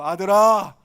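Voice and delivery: loud call to a son. Shown as a demonstration. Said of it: "한 번 더요".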